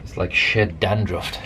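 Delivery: normal talking voice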